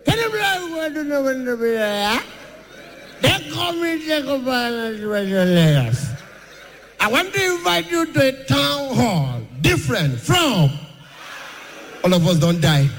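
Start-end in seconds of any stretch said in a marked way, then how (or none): 0.1-10.7 Tinubu speech impression. Tinubu inviting people to a town hall, mock high voice.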